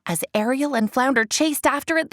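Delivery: usual speaking voice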